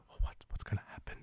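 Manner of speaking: fast whispered gossip of watching execs. Retold or imitated. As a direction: hush it quickly like spectators behind glass. Execs watching behind glass.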